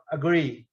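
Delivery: "agree" with a weak a-, stress on -GREE. Shown as a demonstration. Teacher shows students the stressed syllable of agree. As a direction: hit the second syllable of "agree". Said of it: "esse pontinho em cima, é agree".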